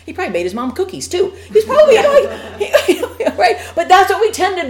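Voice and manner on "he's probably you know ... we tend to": much higher, laughing through the words; can barely get it out for laughing. Real, not acted. Laughing at own joke.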